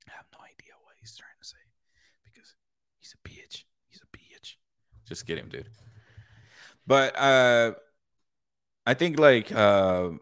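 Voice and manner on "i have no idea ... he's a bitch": unvoiced whisper behind his back; whispered jab. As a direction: whisper the insult like a secret. Joking aside to audience, whispered.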